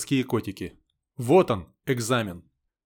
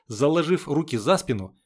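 ordinary delivery, nothing marked

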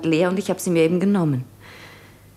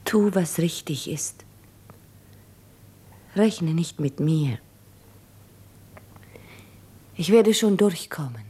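tearful whisper. Acted. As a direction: whisper it so he won't hear you break. Lara parting in tears, hushed.

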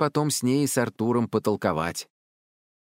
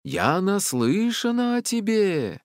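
slow, high, drawn-out call; echoing forest voice; 11 semitones higher, slower than usual. A narrator's reading. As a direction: proclaim it so it carries through the trees. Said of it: "Её слова эхом разносились". (протяжный раскатистый голос)

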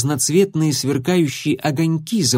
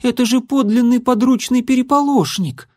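put-on high, alarmed girl; 11 semitones higher than usual. A narrator's reading. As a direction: gasp it out in alarm. Issy alarmed.

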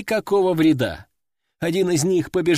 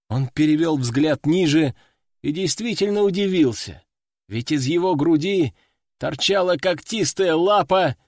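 usual speaking voice

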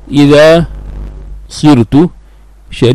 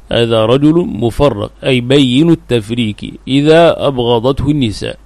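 ordinary delivery

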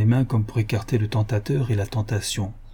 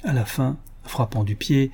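usual speaking voice

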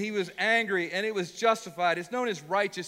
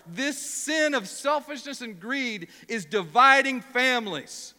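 slow, louder stress on dividing; emphatic; 4 semitones higher, 4 dB louder, slower than usual. Real, not acted. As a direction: lean into each word, slowly. Preacher stressing sin's harm.

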